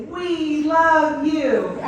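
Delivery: high, loud, drawn-out reply; band calling back. Retold or imitated. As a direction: stretch it out like a crowd answering. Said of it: "the band will look back at him and will say".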